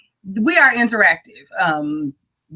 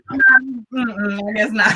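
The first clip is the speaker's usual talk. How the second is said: ordinary talk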